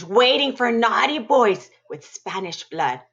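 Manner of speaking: ordinary delivery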